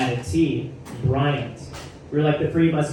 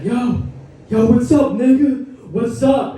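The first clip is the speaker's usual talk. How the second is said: higher, louder hyped-up buddy greeting; Brian's loud greeting; 7 semitones higher, 6 dB louder than usual. Retold or imitated. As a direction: call across the party like his best friend. Brian greets him at party.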